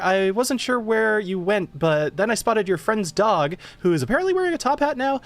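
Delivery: high, quick chirpy gnome voice; excited gnome girl. Acted. Gnome tinkerer rambling to Anomio, high and excited. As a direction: gush it all out breathlessly in a high voice.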